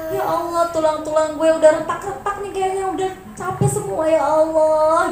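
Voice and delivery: a plaintive, crying plea to God; tearful prayer. Acted. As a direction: lament your weakness as if near tears. Tearful prayer acted as model.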